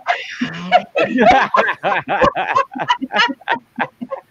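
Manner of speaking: loud, high burst of laughter; a real laugh that breaks out when the message is shown on screen; big laugh. Real, not acted. Host laughing loudly at being put on the spot.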